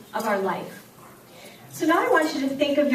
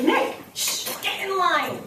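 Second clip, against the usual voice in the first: teacher shushing; 6 semitones higher, slower than usual. Retold or imitated. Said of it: "my reaction is".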